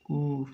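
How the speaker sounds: "ऊ" as a held oo; long ऊ sound. Shown as a demonstration. Teacher demonstrating the vowel, long held "ऊ". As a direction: hold a lone "ऊ" vowel. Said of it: "ये long ऊ sound है".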